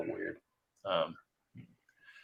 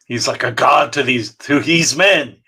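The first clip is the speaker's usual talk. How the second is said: mock-dramatic; 3 semitones higher, 10 dB louder than usual. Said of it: "the French accent thing".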